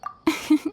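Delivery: short breathy polite laugh; a quick amused breath of a laugh. Acted. Catherine laughs at Paimon's boast.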